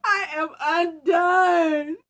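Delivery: loud, high, hammed-up sob; mock crying shout; blubbering. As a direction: wail it like a defeated bear, slow at the end. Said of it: "He blubbered".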